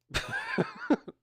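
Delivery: a sudden high-pitched giggle; high squeaky laugh. Real, not acted. Laughs after recalling her words.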